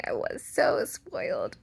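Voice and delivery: deep mock confession; low confessing voice. Real, not acted. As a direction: drop low and admit it. Confessing after just kidding.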